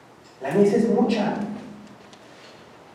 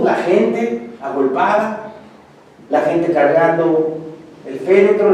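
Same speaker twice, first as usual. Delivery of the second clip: normal talking voice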